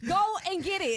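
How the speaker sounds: high, insistent order; still triggered. Acted. Wife orders husband to retrieve stroller, mock-triggered. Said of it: "you're still triggered right now".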